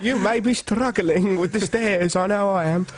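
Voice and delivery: high, slow mock-medieval dame; grand old lady impression, drawn out; Dame Thora. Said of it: "Dame Thora doing medieval".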